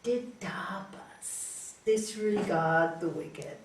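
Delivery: slow, soft, pious confession; acted saintly hush, very slow; hushed reveal. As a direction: drop to a near whisper and draw it out. Disciple proudly reveals his quiet restraint.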